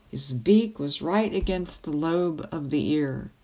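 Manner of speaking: usual speaking voice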